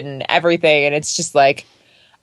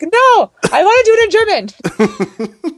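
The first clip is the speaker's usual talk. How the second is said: high, slow, drawn-out insistence; stubborn whine; 12 semitones higher than usual. Retold or imitated. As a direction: plead your case like a stubborn kid. Her imagined stubborn reply.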